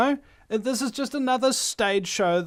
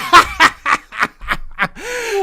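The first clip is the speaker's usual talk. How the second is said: loud mocking cackle, much higher; jeering laugh; 9 semitones higher, 7 dB louder than usual. Radio host mocking a politician in the street, jeering laugh. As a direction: laugh in their face. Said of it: "point at them and go".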